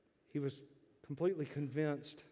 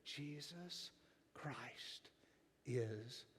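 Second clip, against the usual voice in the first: soft whisper; 14 dB quieter, much slower than usual. Shown as a demonstration. Preacher closing his sermon to the congregation, whispered. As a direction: whisper it like a treasured secret. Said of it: "simply tell you".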